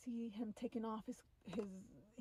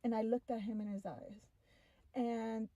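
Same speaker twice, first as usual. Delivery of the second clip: ordinary talk, nothing marked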